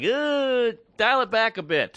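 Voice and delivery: recoil, then rein the comic in; disgusted objection. Retold or imitated. Producers balking at a crude joke, mock disgust. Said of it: "they were like".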